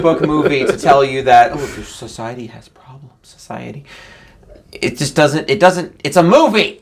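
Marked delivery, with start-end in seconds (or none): 1.5-3.8 quiet mocking voice trailing to a whisper. Mocking naive moviegoer. 4.7-6.8 exasperated. Real, not acted. Exasperated outburst.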